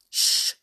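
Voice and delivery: long, voiceless shush; hushing. Acted. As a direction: shush them urgently. Moonface hushes the bear.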